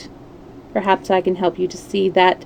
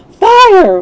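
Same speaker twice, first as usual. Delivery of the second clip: full shout, much higher and louder; yelled; 11 semitones higher, 9 dB louder than usual. A narrator's reading. The men shout fire to flush out the witness, loud shout. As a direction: bellow it like raising the alarm. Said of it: "we all yelled".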